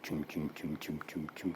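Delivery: low, soft chugging engine sound; low quiet chum chum of a motor; boat engine noise. Retold or imitated. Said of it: "keeps going".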